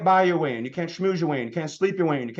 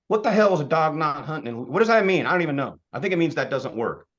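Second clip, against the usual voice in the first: usual speaking voice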